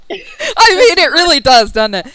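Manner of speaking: loud high laughter through the words; laughing. Real, not acted. GM cracking up at the player's joke, loud laughing. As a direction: laugh it out, can barely speak.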